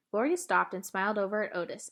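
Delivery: plain reading voice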